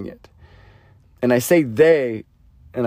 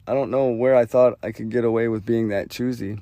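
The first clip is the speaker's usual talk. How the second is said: ordinary delivery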